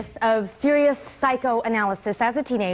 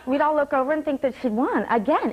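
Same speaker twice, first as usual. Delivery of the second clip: normal talking voice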